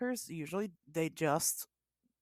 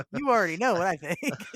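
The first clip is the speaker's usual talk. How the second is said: laughing tease; 4 semitones higher, 7 dB louder than usual. Real, not acted. Host laughing at the question.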